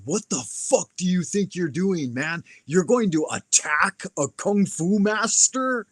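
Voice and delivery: incredulous mock yell, stretched at the end; retold yell. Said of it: "I yelled in his face".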